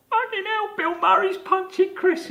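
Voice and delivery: very high, squeaky mock astonishment; stunned disbelief. Castmates watching Bill Murray punch Chris, squealing in disbelief. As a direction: ham up the guys' jaw-dropped reaction. The guys reacting off camera.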